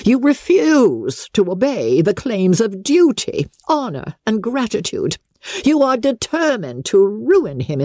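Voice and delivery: haughty accusing aristocrat; lightly voiced imperious lady; Lady Catherine voice. A narrator's reading. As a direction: press the charges one by one.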